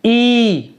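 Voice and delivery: ई held long as "eee"; long ई sound. Shown as a demonstration. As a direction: draw out ई to show the long vowel. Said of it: "बड़ी ई का उच्चारण जो होता है". (बड़ी ई को खींचकर)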